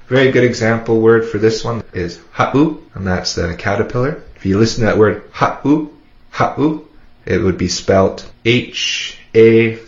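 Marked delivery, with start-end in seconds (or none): 2.3-2.8 ha'u broken by a glottal catch before the u; ha'u in two parts, ha, tiny stop, u. Shown as a demonstration. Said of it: "The seven represents a glottal stop". 5.3-6.9 ha'u with a clean catch between ha and u; ha'u split in two by a glottal stop. Shown as a demonstration. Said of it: "The seven represents a glottal stop".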